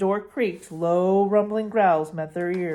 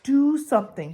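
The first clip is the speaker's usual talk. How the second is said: mild desperation; 7 semitones higher, 5 dB louder than usual. Acted. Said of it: "he said desperately".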